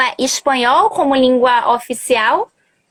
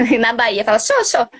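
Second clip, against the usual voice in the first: ordinary delivery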